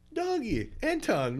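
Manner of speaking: high squeaky call of the dog's name; mock the coach calling the dog; imitating coach's broken English. Athlete imitates his old coach calling the dog. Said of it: "'cause he has broken English".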